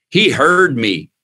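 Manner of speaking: ordinary talk, nothing marked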